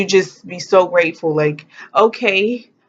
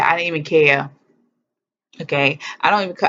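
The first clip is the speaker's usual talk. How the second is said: usual speaking voice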